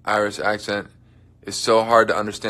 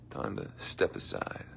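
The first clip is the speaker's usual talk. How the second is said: quiet aside; 6 semitones lower, 13 dB quieter than usual. Pretend.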